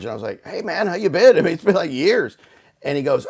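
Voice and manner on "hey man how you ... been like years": high, loud happy hello; delighted, raised-voice reunion greeting; excited greeting. Retold or imitated. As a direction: greet a long-lost buddy, pitched up. Acted greeting to long-lost buddy.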